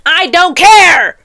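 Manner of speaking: loud, high, drawn-out outburst; explode, stretching the words into a yell; exasperated shout. Real, not acted. Cutting off a lovestruck heroine, exasperated shout.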